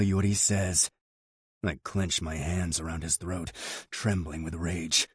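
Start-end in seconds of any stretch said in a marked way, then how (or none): none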